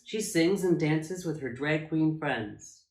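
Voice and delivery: ordinary delivery, nothing marked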